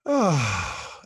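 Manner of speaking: long, falling "ah" of relief; relieved sigh. Shown as a demonstration. Speaker showing the relief when we stop defending, big sigh. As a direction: let out a big audible sigh.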